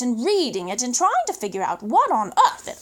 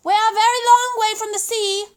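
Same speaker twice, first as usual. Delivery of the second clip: calling up, louder and higher; calling back; 6 semitones higher, 5 dB louder, faster than usual. Acted. Boy calls up to the pelican at the window. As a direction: call up to the window. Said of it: "I called back to him".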